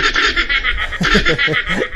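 high laugh tumbling downward; laughter. Real, not acted. Laughs at own call-out. (halakhak na pababa)

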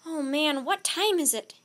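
sudden dawning panic; alarmed realisation. A narrator's reading. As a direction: blurt it out in rising panic. Jack realises eruption is today.

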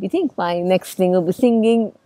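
ordinary delivery

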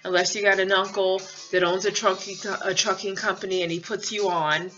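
ordinary talk, nothing marked

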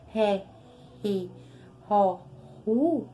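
ré, ri, ro, ru with a throaty strong r; strong r syllables. Shown as a demonstration. Teacher drills the strong double-r sound, syllable by syllable. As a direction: voice the r hard from the throat in each syllable. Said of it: "porque o R é duplo".

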